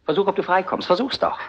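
jeering challenge while holding her; taunting. Acted. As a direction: goad her cruelly. Man taunts the struggling woman.

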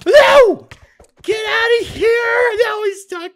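high, loud panicked yell; panicked shout. Real, not acted. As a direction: scream at the mob in real panic. A mob charges at her.

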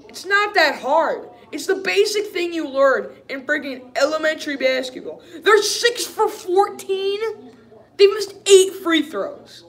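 high, loud, incredulous tirade; exasperated rant. Real, not acted. Fan fed up with basic misses, exasperated. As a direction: rant at real frustration pitch. Ranting about missed free throws.

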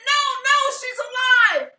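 high, loud frantic protest; urgent crying out. Retold or imitated. Boyfriend telling the doctor she is alive, frantic shout. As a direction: shout to stop them before it is too late.

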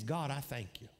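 low, soft inward prayer; quiet thanks. Retold or imitated. Preacher thanking God mid-storm, quiet thanks.

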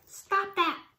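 high, sharp command; storybook shout. A narrator's reading. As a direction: shout it in protest, pitched well up. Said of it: "Annie shouted".

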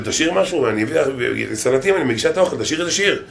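soft pleading wife; hint of a nagging wife; coaxing wife. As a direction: coax him softly to sing. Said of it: "אומרת לו".

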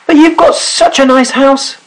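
high, eager little sailor voice; high rabbit voice. Acted. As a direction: squeak it out like a small rabbit. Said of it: "said the sailor".

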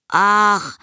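put-on high, drawn-out boyish sigh of reluctance; a boy put out at being interrupted; reluctant boy. A narrator's reading. Boy fobbing off his mother. (widerwilliger Junge, hoch)

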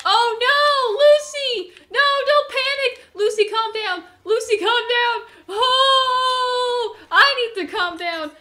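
high, loud frantic yelling; panicked shouting. Real, not acted. Player yelling to his panicking horse Lucy, frantic high shout. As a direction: shout in a frenzy, begging calm.

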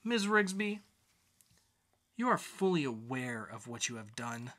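a hint of a stern judge, measured. Judge warning the defendant, mild sternness.

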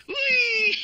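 high gleeful squeal and laugh; gleeful whee. Acted. Simon celebrating his power, squealing. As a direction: squeal with delight.

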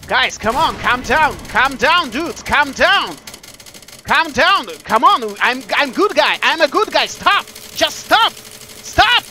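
loud, high plea, climbing to the final stop; urgent pleading. Real, not acted. Begging viewers not to kick him.

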